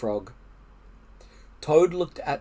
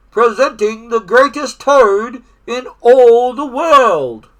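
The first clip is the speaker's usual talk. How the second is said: high, loud, drawn-out stage announcement; strange distant announcer; 8 semitones higher, 11 dB louder than usual. A narrator's reading. Strange voice announces Toad on stage, booming announcer. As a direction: present the star act slowly and grandly. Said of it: "A strange voice from very far away said".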